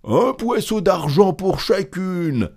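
high-pitched hawker's refrain; called-out price. A narrator's reading. Grand Claus repeating his price to every buyer, high hawker's call. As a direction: sing out the fixed price.

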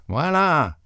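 high-pitched faraway waiter's cry; high, mock distant call; shrill. A narrator's reading. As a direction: sing out a piercing answer from afar. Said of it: "sur aigu".

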